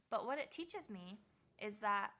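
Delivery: normal talking voice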